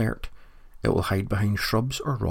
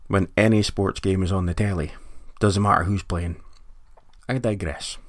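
ordinary delivery

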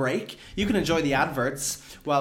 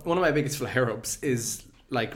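ordinary talk, nothing marked

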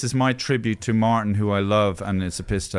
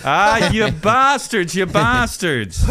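mock outrage; 7 semitones higher, 4 dB louder, slower than usual. Laughing at the pub prank.

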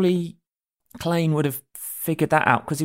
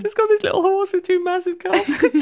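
high amused voice. Pretend. Picturing laden horse, amused.

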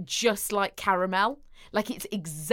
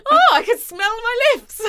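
high, loud, delighted squeal; mock amazement; 14 semitones higher, 10 dB louder than usual. Retelling her reaction to the scented lips, high and loud. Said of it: "I was like".

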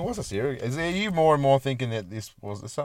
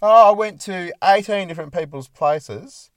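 higher, louder mock-earnest report; imagined salesman; 5 semitones higher, 6 dB louder than usual. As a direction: lightly play a salesman telling Mum about his day. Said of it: "What'd you do today, Larry?".

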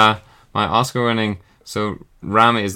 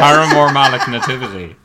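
laughing delivery; 4 dB louder than usual. Real, not acted. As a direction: announce the silly answer, cracking up. Repeats the solved silly answer amused.

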